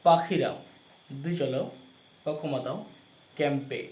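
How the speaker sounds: slow dictation for note-taking; dictating. Shown as a demonstration. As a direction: read each title out slowly, pausing. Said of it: "খাতায় লেখো".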